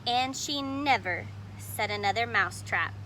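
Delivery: ordinary delivery, nothing marked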